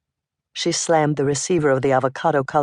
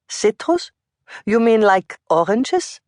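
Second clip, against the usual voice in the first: put-on high, questioning German accent; 7 semitones higher than usual. A narrator's reading. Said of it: "asked in her German accent".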